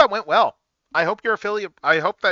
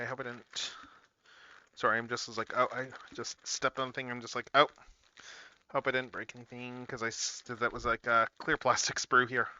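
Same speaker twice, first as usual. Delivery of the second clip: worried mumble; 6 semitones lower, 10 dB quieter than usual. Real, not acted. Stepped on a part, worried.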